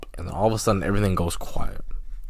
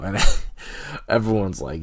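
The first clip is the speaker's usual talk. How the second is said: usual speaking voice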